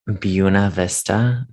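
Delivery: "Buena" drawn out as BYOO-nuh; quoted Americanized pronunciation. Retold or imitated. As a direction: say "Buena" slowly with a BYOO start. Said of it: "And when you said".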